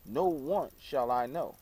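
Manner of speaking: usual speaking voice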